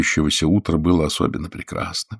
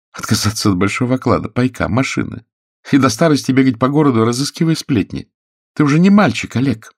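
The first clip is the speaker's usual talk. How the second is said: high woman's voice, growing louder; reproachful actress; 7 semitones higher, 4 dB louder than usual. A narrator's reading. Actress reproaches Oleg for staying a reporter, indignant. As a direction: scold him for throwing it away.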